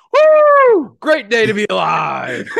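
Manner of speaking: a huge whoop, then shout the rest with a laugh; high, loud, laughing morning yell; joyful yell. Retold or imitated. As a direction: burst in hollering with glee. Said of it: "yelling like that with these headphones on".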